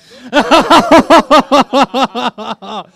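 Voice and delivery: long, high burst of laughter; loud laugh. Real, not acted. Loud laughter mid-game.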